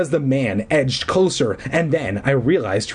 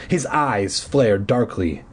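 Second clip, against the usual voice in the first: plain reading voice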